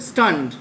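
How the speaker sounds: স্টান্ড said as one syllable, in a single beat. Shown as a demonstration. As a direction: say স্টান্ড at once, no break. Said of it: "একবারই উচ্চারণ হবে একসাথে". (স্টান্ড একবারে, এক সিলেবলে)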